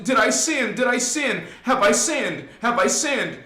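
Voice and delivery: frantic, high-pitched guilty fretting; psycho worrying. Acted. Churchgoer checking for sins over and over, frantic. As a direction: obsess over it like you're going cuckoo. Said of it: "You go psycho, you go cuckoo".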